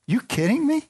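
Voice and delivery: very high disbelieving squeak; incredulous fish. Acted. The fish answers the Lord in high disbelief.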